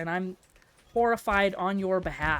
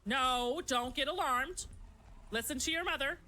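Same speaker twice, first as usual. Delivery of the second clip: normal talking voice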